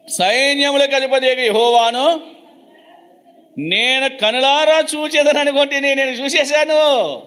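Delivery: high, slow awestruck cry; lightly voiced stricken prophet; Isaiah's lament. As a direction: wail it out in dread. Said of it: "యెషయా గ్రంథము ఆరో అధ్యాయము".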